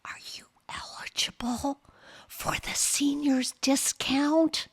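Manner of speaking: whispering clerk. Retold or imitated. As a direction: whisper it like it's embarrassing. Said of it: "in a whisper".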